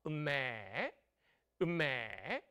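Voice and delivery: low drawn-out "음매" like a cow; cow's moo. Shown as a demonstration. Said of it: "소가 어떻게 울어?".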